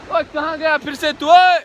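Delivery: high-pitched call after a friend. Real, not acted. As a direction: call out to someone who has wandered off. Calls after friend who vanished.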